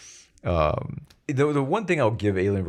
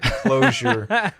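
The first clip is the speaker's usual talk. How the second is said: laughing; 14 semitones higher, 5 dB louder than usual. Real, not acted. Laughing mid-word while joking.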